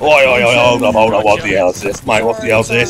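lightly voiced Australian bloke rallying his mates; easygoing Australian accent, mate-heavy; a touch of Aussie accent for a cheeky squad leader; Australian officer. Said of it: "it's Australian Ted".

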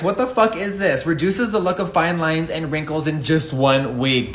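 ordinary talk, nothing marked

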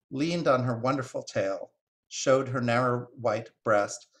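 plain reading voice

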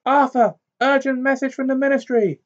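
high loud urgent call, fast; yelling across the room. A narrator's reading. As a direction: shout it up the stairs in a hurry. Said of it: "she called up the stairs".

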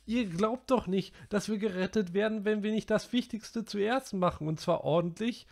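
high, loud scolding; Piggy's tirade. A narrator's reading. Piggy lecturing the boys about rescue, high scolding tirade. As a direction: scold them all. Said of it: "seine Schimpftirade".

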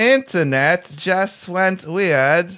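slow, high, hammed-up British accent; overdone posh British English, drawn out. Reading a friend's chat message in a mock British accent. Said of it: "read that in a British accent".

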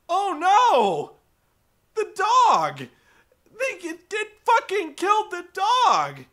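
high, loud anguished outcry; distraught viewer cry. Retold or imitated. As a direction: wail in dismay for the dog. Viewer reacting to dog hurt.

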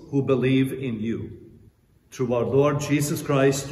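solemn intoned prayer. Real, not acted. Priest intoning collect prayer.

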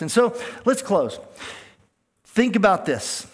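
preacher raising his voice to wrap up; rising preacher call. Real, not acted. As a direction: lift the room for the final point. Preacher rallies for the close.

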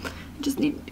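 high, breathy plea under breath; timid whisper. Retold or imitated. Blocked by a girl gang, high timid whisper. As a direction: squeak it out, trying to get past.

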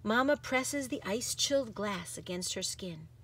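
ordinary delivery, nothing marked

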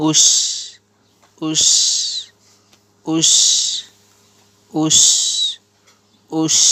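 "ush" with a long, strong sh; "ush" repeated singly, with pauses; drilled "ush". Shown as a demonstration. Teacher shows alif pesh shin jazm, drilling "ush". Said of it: "আলিফের পেশ শিনের জজম".

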